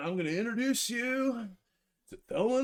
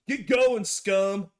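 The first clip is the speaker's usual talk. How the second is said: louder, chest-out sneer; contemptuous dismissal; 5 dB louder, much slower than usual. Acted. Rogue dismissing the waiter with contempt. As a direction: spit it at him like a boss. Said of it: "I puff my chest out".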